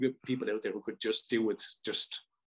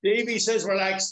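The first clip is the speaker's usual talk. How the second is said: mock coach echo; 8 semitones higher, 5 dB louder than usual. Echoing the friend's impression back.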